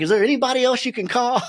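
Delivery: high, quick mock dismay; retold disbelief, high and hurried; doubtful caller.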